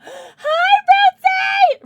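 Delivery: very high, loud squeal; gasp, then shriek the greeting; ecstatic greeting. Real, not acted. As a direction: squeal hello at the top of your voice. Greeting a viewer while overcome with joy.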